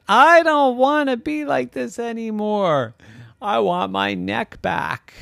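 high, whining lament; miserable llama. Acted. Llama regretting his wish, miserable. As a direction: wail it in a high, upset voice. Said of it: "he felt so bad".

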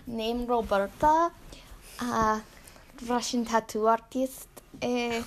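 halting, deadpan put-on Russian accent. Tattoo artist explaining pet pig practice, put-on Russian accent. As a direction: search for words in a mock Russian accent. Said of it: "I'm gonna do my Russian accent now".

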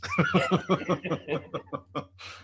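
high, rolling laugh; hearty laugh. Real, not acted. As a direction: crack up at a crude joke. Cracks up at a crude joke.

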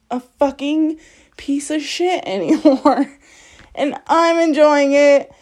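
ordinary talk, nothing marked

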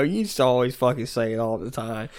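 ordinary delivery

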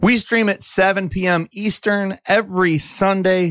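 ordinary talk

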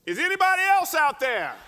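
loud, high cry to the sky; desperate shout. Acted. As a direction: shout it out, hoping someone else answers. Man on branch calls again.

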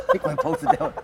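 laughing squeal. Retold or imitated. Comedian recalling fleeing the hotel, laughing high.

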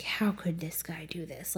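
hushed, resentful mumble under the breath; grumbling whisper. Retold or imitated. Novice monk seething silently about his teacher, whispered grumble. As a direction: seethe it quietly to yourself. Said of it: "mumbling and grumbling in his head".

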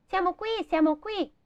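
high squeaky bird voice singing comfort; tiny sparrows chirping to cheer up the girl. A narrator's reading. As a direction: chirp it like little sparrows consoling her. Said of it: "cantarono come per consolarla".

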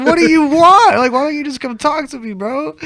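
yelling. Retold or imitated. Teen yelling at his dad through the door, loud and high. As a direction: shout through the door for him to just come talk. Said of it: "I'm yelling from my room".

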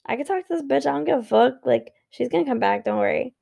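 smug shrug of a voice, dropping down by the end; mocking careless partner. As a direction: play the partner who knows she'll come back. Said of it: "they're gonna be like".

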